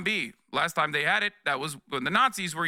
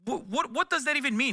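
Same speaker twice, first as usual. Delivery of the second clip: exasperated disbelief; 9 semitones higher than usual. Real, not acted. Incredulous at chat question.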